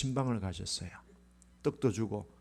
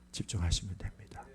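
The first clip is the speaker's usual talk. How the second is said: whisper; 8 semitones lower, 8 dB quieter than usual. Real, not acted. Closing exhortation hushed.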